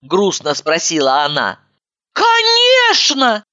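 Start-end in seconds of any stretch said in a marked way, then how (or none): 2.1-3.4 high, slow, fervent exclamation; heartfelt exclaim. A narrator's reading. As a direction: cry it out with feeling. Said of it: "с чувством воскликнула я".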